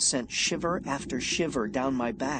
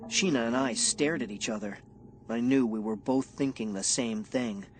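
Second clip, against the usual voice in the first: plain reading voice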